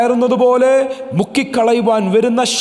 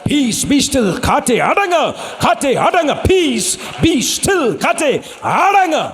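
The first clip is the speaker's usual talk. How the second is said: shouted command; 6 semitones higher, slower than usual. Shown as a demonstration. Pastor leading the church rebuking the wind, shouted high. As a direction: rebuke the storm at full voice. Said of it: "Lift up your voice and say".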